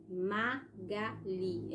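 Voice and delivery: Magali stretched out syllable by syllable; slow careful pronunciation. Shown as a demonstration. Teacher showing children how to say a word before writing it, slowly. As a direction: spell out Magali slowly with the mouth. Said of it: "É sempre bom fazer a pronúncia".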